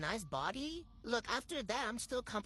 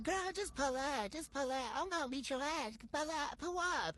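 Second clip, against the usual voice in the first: higher-pitched, goading challenge; mock taunting rival; 6 semitones higher than usual. Rival dares her to show up, mock taunting. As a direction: imitate a rival egging on a fight.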